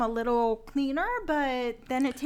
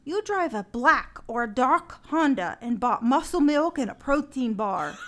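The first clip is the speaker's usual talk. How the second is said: ordinary delivery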